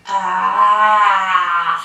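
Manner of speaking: wide-open-mouth "aah"; wolf opens his mouth. Acted. Wolf shows the rabbit his mouth, saying aah. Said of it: "ouvre la gueule pour voir".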